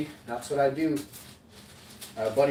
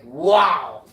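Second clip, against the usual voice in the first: wow; 3 semitones higher, 13 dB louder than usual. Retold or imitated. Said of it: "And I'm like".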